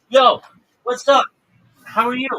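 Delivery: big excited hello to the newcomer; shouted, high-pitched welcome; loud hype greeting. Real, not acted. Loud greeting to stream guest.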